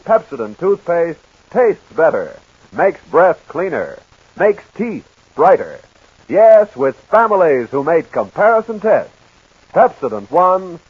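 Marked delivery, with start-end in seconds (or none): none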